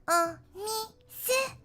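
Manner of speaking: very high, drawn-out cutesy squeal; cringy high-pitched voice. Shown as a demonstration. Streamer demonstrating her cringy voice to chat, cringy high-pitched. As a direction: squeak it slowly, syllable by syllable. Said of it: "Cringy version".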